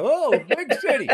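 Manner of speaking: high, awed country bumpkin; wide-eyed rube. Acted. Mocking his wide-eyed rube self.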